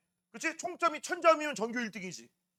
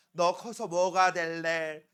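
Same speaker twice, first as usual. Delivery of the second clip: slow, drawn-out parent's nag, pitched lower; parental nagging; 7 semitones lower, much slower than usual. Acted. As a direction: nag like an exasperated parent, stretching the words. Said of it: "잔소리를 막 한 거야". (느리고 낮게 늘어지는 부모 잔소리)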